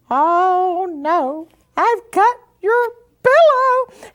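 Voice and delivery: horrified at ruining her pillow; high, loud wail of oh no; dismayed. Retold or imitated. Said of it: "I said".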